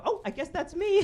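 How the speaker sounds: high, loud, put-on feminine volunteering; mock damsel. Passenger claiming rescue from the bus driver, high mock-damsel voice. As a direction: pipe up suddenly as the damsel.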